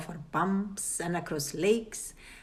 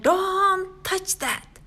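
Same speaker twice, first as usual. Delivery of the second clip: high, slow, drawn-out yell; 12 semitones higher, 4 dB louder, much slower than usual. A narrator's reading. Father yelling at Cheryl not to grab the candy, loud slow yell. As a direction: yell a warning in panic. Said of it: "Her father yelled".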